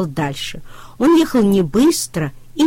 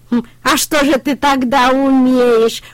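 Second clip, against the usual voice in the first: higher, louder, puzzled duckling voice; surprised ducklings; 6 semitones higher, 5 dB louder than usual. A narrator's reading. Утята удивляются трактору, высоко и удивлённо. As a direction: ducklings can't believe it. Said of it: "удивились утята".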